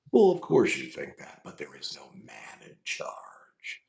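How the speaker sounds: drops to a slow, low whisper; cold, calculating menace; Ultron voice. Retold or imitated. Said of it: "I'm doing Ultron".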